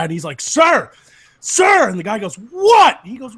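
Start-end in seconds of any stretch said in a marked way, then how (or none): 0.4-1.9 high, loud cop's shout; escalating, exasperated call; insistent shouting. Acted. Cop shouting for attention. 2.3-3.0 high, loud irritated yell; hammed-up annoyed shout; startled snap. Interrupted man snaps back.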